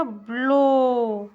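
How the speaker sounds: Blow drawn out very slowly, long ओ; slow model word. Shown as a demonstration. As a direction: stretch the ओ in blow for the class. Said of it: "O W की ध्वनि ओ आती है". (Blow धीरे, लंबी ओ की ध्वनि)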